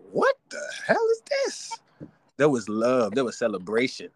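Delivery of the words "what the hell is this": very high incredulous squeal; shocked disbelief. Retold or imitated. Host replaying his own shocked reaction, high squeal. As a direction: react like you can't believe it. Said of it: "I was like".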